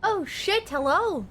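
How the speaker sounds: high squeaky delighted squeal; cute anime streamer. Real, not acted. Surprise raid arrives mid-stream.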